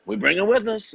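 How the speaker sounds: high-pitched parent voice. Retold or imitated.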